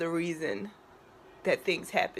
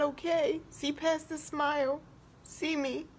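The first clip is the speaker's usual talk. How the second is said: higher and softer, pleading; silent plea; 8 semitones higher, 3 dB quieter than usual. Retold or imitated. Woman silently begging a stranger for help, softly pleading. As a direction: beg to be seen, slowly. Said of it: "I'm looking at her almost to say".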